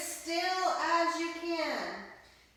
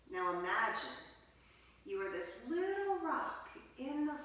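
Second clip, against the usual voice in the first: soft, hushed meditation voice; guided meditation; 7 dB quieter than usual. Acted. Teacher guiding children into quiet listening, hushed. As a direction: settle the children into stillness.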